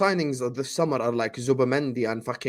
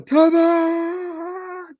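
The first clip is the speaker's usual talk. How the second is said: high, drawn-out call of the name; 13 semitones higher, 4 dB louder than usual. Real, not acted. Host greeting his friend Tevin by calling his name out.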